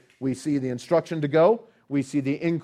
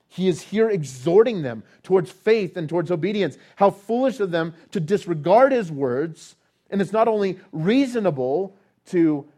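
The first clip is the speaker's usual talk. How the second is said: louder, higher exhorting sermon voice; preaching at full force; 5 semitones higher, 6 dB louder than usual. Acted. Preacher exhorting congregation to obedience, preaching at full force. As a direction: exhort them with rising force.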